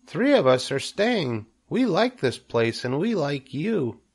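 high, small friendly voice; little spider. A narrator's reading. Spider tells Wilbur they are staying, high voice.

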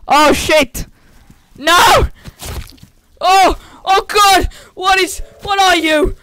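high, loud panicked shouts; frightened yelling. Real, not acted. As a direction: yell in real terror as it lunges. Monster attacks in horror game.

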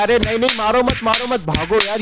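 hammed-up alarm; mock panic. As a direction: plead not to be hit, then run. Pretends to flee attacking zombies.

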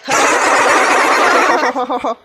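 big breathy guffaw; loud laugh. Acted. Laughing at the food banter, loud breathy guffaw. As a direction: burst out in a long loud laugh.

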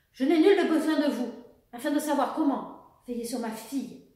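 loud, high, forceful rebuff; indignant exclamation. A narrator's reading. Mother rejects the officer's advice about her daughter, exclaiming indignantly. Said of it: "s'exclama Sophie".

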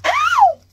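sudden high squeal of pain; a sharp surprised ow; startled yelp. Real, not acted. Unboxing the purse, a sudden yelp.

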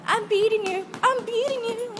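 high, loud teasing boast; gleeful taunt. Real, not acted. Racing her friend in the tunnel, gleeful loud taunt. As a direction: crow about winning the race.

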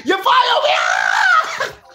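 shouted, high fight call; hyped live play-by-play. Real, not acted. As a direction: yell the knockdown like it's live.

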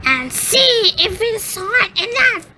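very high, sly witch. Acted. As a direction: lure the girl into the oven. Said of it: "said the witch".

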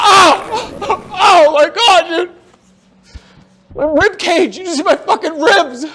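high, loud yelp of pain, half laughing; pained shout. Real, not acted. Friend just hit his ribs.

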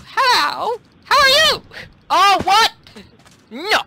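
very high, loud squeal; high-pitched greeting. Real, not acted. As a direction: squeal the words out loudly. Greets opponent during attack.

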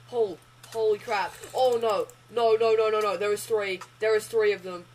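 loud alarmed cries; panicked yelling. Real, not acted. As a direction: cry out as they swarm you. Swarmed by pigmen.

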